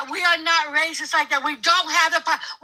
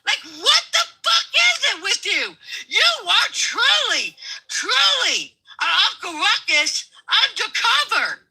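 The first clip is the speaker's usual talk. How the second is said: high, slow, shouting in outrage; real outraged yelling; furious shout; 6 semitones higher than usual. Real, not acted. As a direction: yell it at him, disgusted. Arguing over the word blacks.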